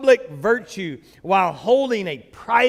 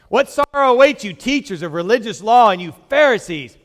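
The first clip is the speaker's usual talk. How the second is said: louder forceful scripture reading; preaching scripture; 7 dB louder than usual. Acted. Pastor reading Jesus' rebuke to the congregation, forceful. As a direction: proclaim the woes with weight.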